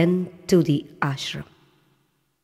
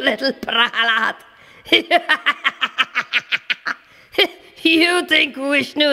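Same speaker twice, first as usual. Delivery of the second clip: high, cackling scornful taunts; mocking villain laugh; 4 semitones higher than usual. Demon king taunts little Prahalad, mocking laughter. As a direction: sneer and laugh at the child.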